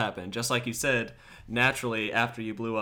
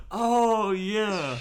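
surprised gasp; 10 semitones higher, 3 dB louder than usual. Real, not acted. Sudden realization gasp.

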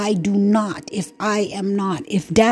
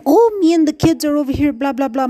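higher, louder, quick mock-breezy brush-off; 6 semitones higher, 4 dB louder, faster than usual. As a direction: sneer it like his lazy excuse. Mocking the father's excuses.

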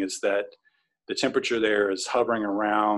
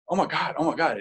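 higher-pitched replayed "oh my God" panic; retold alarm; 7 semitones higher, faster than usual. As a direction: reenact alarm with a raised pitch. Retold reaction to commotion outside tent.

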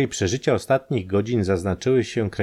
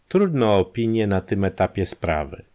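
ordinary delivery

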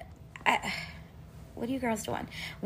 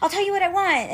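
put-on little girl voice; 12 semitones higher than usual. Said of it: "she'll be like".

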